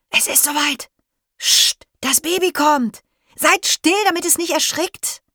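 high, loud, breathless shushing; urgent excited hush. Acted. Child hushes the class before the baby appears, high and urgent.

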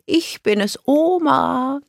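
high, sing-song grandma voice; drawn-out, high-pitched announcement; grandmother calling. A narrator's reading. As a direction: sing it out to sleepy children. Grandmother calling to waking girls.

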